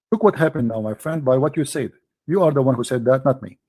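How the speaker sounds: ordinary talk, nothing marked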